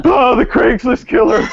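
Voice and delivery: high, hammed-up murder-victim wail; mock victim's cry. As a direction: cry out as if being stabbed, playfully. Acted victim being stabbed in improv.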